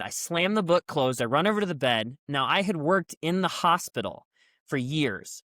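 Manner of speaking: ordinary talk, nothing marked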